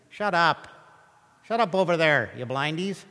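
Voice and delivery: stern crowd. Retold or imitated. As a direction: hush them up like a rough crowd. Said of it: "The crowd sternly told them".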